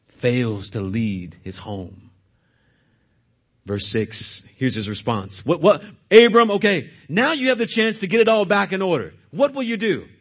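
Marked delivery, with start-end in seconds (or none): 5.4-10.1 louder, pressing challenge; exasperated demand. Acted. Sarai confronts Abram over Hagar, loud demanding. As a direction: push him hard for an answer.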